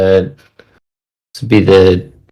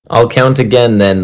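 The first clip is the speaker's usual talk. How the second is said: usual speaking voice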